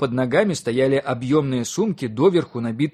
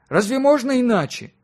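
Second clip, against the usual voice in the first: high-pitched protesting question; exclaiming; 11 semitones higher, 4 dB louder than usual. A narrator's reading. Man defending his plan to the physicist, exclaiming. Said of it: "воскликнул мужчина".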